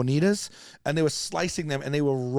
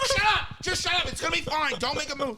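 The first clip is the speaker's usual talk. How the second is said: high-pitched frantic hushing, acted out; panicked scolding; 12 semitones higher than usual. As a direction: order the kids still in comic panic. Re-enacts himself ordering panicked kids still.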